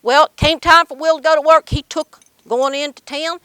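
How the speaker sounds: usual speaking voice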